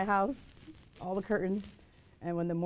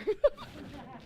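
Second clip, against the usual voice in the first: laugh; 10 semitones higher, 4 dB quieter than usual. Real, not acted. Laughing at own joke.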